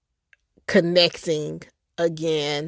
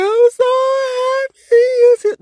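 sobbing much higher and louder with joy; happy crying; 7 dB louder than usual. Retold or imitated. Finding her lost auntie, crying with joy. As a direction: break down in happy tears on the words. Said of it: "I was just like".

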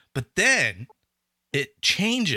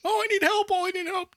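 much higher pleading woman's voice; damsel in distress; faster than usual. Retold or imitated. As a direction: plead for help up high, mock-fragile. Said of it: "she's like".

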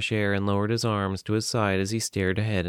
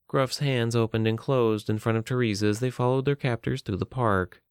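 ordinary delivery

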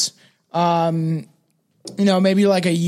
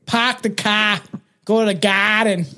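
Boston accent dropping the R in "car" and "garden"; Boston accent demo. Shown as a demonstration. Showing how to drop the R's, a light Boston accent. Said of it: "You just gotta forget your R's".

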